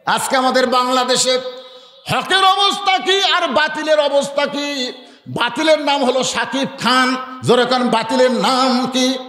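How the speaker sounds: high, singsong preacher's delivery; intoned fiery preaching. Real, not acted. Preacher asking the crowd about truth and falsehood, intoned preaching.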